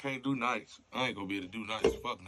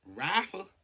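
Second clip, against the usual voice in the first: sharp high startled echo; shocked; 7 semitones higher, 5 dB louder than usual. Acted. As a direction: jolt up on the word. Dispatcher shocked by rifle.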